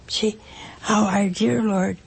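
ordinary talk, nothing marked

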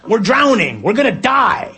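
fast incredulous start rising to high cries; panicked protest. Acted. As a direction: build to comic, high-pitched panic. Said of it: "And we're like".

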